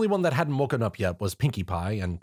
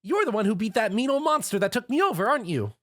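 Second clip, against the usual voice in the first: much higher, bouncy, eager voice; bubbly party pony; 12 semitones higher than usual. Acted. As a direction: gush at the hero you just met. Narrator voices Pinkie Pie.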